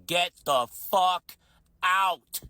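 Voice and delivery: loud, slow, stretched-out command; angry shout. Retold or imitated. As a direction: throw him out with a loud slow roar. Confronting the man at the bar.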